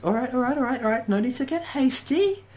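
high, far-off put-on voice for the woman on the bridge; villain backing off. GM voicing the villainess telling the party to calm down, high put-on voice. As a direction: pitch it way up and ease them off, all right all right. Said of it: "she goes".

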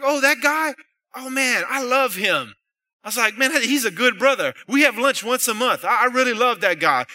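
mock overjoyed praise of the pastor; delighted reply. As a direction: cut the gossip dead with enthusiasm.

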